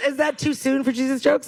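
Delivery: ordinary talk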